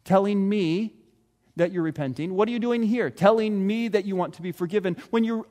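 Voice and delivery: ordinary talk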